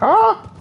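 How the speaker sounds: short, high mock yelp; high-pitched "ah!" of fright, acted; startled yelp. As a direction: yelp as if startled by a sliding corpse. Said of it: "one of the corpses slid down and like".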